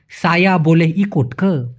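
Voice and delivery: slow, careful Malay: সায়া বলে ই কোট কে, each word distinct; correct careful pronunciation. Shown as a demonstration. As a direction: give the proper Malay pronunciation, slowly. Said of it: "মূলত সঠিক উচ্চারণটা এরকম হবে".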